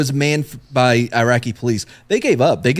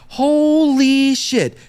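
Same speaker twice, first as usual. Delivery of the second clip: high, long-drawn awe; hammed-up, slow high exclamation; imitating amazed delight; 11 semitones higher, much slower than usual. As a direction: stretch it out in mock amazement. Lieutenant thrilled by the grisly find.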